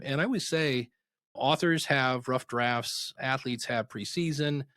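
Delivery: ordinary talk, nothing marked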